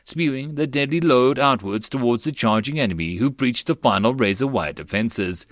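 ordinary delivery

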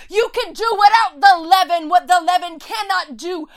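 screaming it out. Real, not acted. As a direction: scream the faith out despite the Pharisees. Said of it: "I'm gonna scream it out all the louder".

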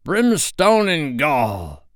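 slow, high mimicked pirate oath; impostor's blustering retort. A narrator's reading. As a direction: fling the captain's curse back, drawn out. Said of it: "the voice retorted".

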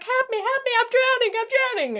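high, hammed-up cries for help; mock drowning cries. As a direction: squeal it like a drowning man in a movie. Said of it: "he's like".

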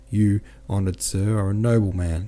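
ordinary delivery

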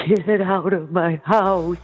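slow, meek and unsure; timid. Shown as a demonstration. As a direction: say it like you're scared of them. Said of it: "you can't just say".

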